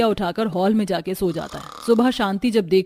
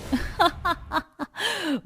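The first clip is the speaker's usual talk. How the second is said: loud laughing at a web series; high, breathy burst of laughter; a woman laughing at a web series late at night, loud laughter; 7 semitones higher, 8 dB quieter than usual. Acted. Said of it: "जोर जोर से हंसती".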